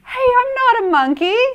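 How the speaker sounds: high, loud indignant protest; shocked protest. Acted. Reader protesting to kids, high and indignant. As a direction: gasp and object loudly.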